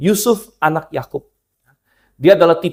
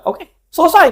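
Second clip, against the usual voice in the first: smug queen; 11 semitones higher than usual. Retold or imitated. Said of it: "Isabel bilang".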